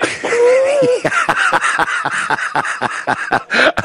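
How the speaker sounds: high rising burst of laughter; real laugh. Real, not acted. Host laughing at the caller's boss story, high burst of laughter.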